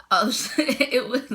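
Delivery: real laughter breaking through the words; struggling with laughter. Real, not acted. Patient cracking up while retelling the hospital story, laughing. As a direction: laugh helplessly while trying to go on. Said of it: "I'm struggling".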